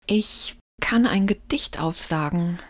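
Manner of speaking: a scared, shaky whisper; a frightened child trying to appease; trembling voice. Acted. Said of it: "meldete sich Lukas mit zitternder Stimme".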